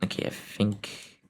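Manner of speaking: soft, slow whisper. Real, not acted. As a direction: hush it under your breath. Quiet aside while playing.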